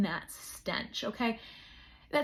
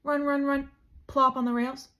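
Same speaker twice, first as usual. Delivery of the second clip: ordinary delivery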